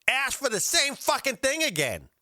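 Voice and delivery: high, incredulous outburst; fuming. Real, not acted. Host outraged at diner's replacement request, fuming. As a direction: raise the pitch sharply in outrage. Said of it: "I'm fucking fuming already".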